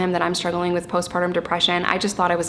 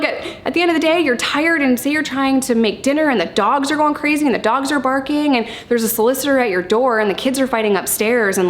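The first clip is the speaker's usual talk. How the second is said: ordinary delivery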